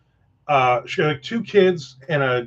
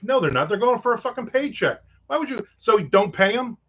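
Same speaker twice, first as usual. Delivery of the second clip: higher, exasperated disbelief; indignant retort; 6 semitones higher than usual. Retold or imitated. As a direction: scoff at the speaker's idea, voice climbing. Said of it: "And I'm like".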